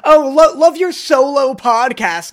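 co-host mocking the fan's praise; sarcastic fan. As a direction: sneer it as a fake compliment.

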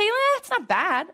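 high, slow, unimpressed shrug; meh. Pretend. Employee shrugging off the question, unimpressed. Imagined lukewarm employee answer.

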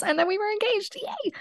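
soft, very high little squeal; real giddy celebration. Real, not acted. Announcing her engagement to viewers, giddy high squeal. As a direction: rise into a tiny high cheer.

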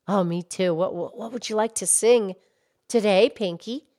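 put-on low, soft old lady voice; gentle granny puppet character; Mildred voice. Puppet Mildred talks to Pinky.

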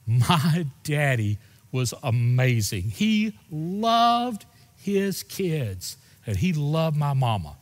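slow, tender words about his father, voice near breaking; emotional. Real, not acted. Son remembering his late father to the church, slow and emotional. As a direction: let the love for your dad slow you down. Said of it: "I get emotional".

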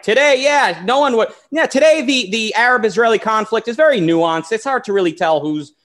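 high mocking sarcasm; sarcastic. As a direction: sneer it out as a joke. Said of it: "Obviously, I'm being sarcastic".

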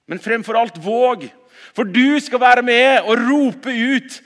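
loud, high ringing preacher's call; forceful preaching. Acted. Pastor rousing the congregation to go out, forceful preaching. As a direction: proclaim it to the whole hall.

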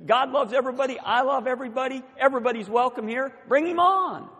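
high, expansive, building to a loud finish; exuberant welcome. Retold or imitated. Pastor welcoming a woman's husband, exuberant. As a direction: throw the doors open to everyone.

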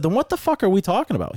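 high, incredulous comeback, retold; exasperated retort. Racer retelling argument with a non-driver, exasperated retort.

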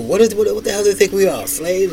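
mock-furious at the bosses; retold yelling. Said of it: "now I'm yelling at people".

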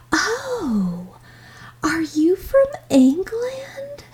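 high, drawn-out wondering question; curious store lady. Retold or imitated. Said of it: "and the lady asked me".

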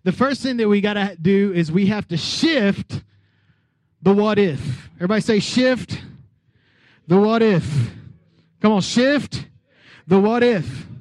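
loud, full-force sermon voice; preaching. Acted. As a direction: preach it out to the whole church. Preaching to the congregation.

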